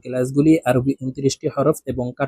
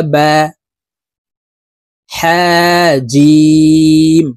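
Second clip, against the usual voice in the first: letters spoken slowly and drawn out, one by one; measured teaching recitation; slow letter drill; 7 dB louder, much slower than usual. Shown as a demonstration. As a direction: model each letter for the students to repeat.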